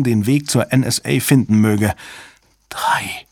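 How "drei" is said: voiceless, disbelieving whisper; stunned whisper. A narrator's reading. Susan stares at the fingers and understands, stunned whisper. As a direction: whisper it as if you cannot believe it. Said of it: "flüsterte Susan fassungslos".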